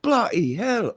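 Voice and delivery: put-on Australian accent, comic amazement; mock Australian neighbor. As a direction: gawk like an amazed Aussie neighbor. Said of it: "They would have been like".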